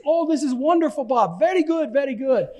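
imitating the friend's high, delighted praise. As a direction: quote him as if thrilled by the news. Afghan friend delighted by pastor.

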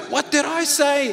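high-pitched teacher's demand. Acted. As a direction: pitch it up over a noisy class. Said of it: "you raise your voice".

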